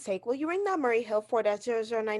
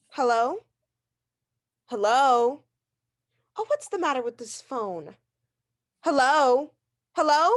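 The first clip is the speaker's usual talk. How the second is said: louder and louder into a dead line; calling into phone; 3 dB louder than usual. Acted. Invalid calling into a silent receiver, rising alarm. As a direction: shout down the phone, nobody answers.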